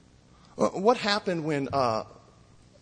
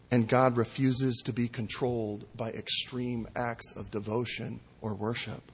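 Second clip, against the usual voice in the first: ordinary talk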